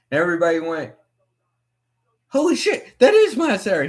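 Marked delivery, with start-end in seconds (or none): 2.3-3.9 high, loud starstruck exclamation; excited crowd reaction. Retold or imitated. Crowd of fans spotting Matt Serra, high excited exclamation. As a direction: blurt it out like a starstruck fan.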